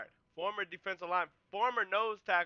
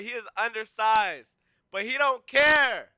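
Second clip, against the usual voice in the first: building excitement; 3 semitones higher, 10 dB louder than usual. Real, not acted. Builds to the big hit.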